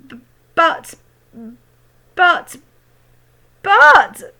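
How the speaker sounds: shocked rising stammer. A narrator's reading. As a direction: stutter in disbelief. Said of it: "stammered George's mother".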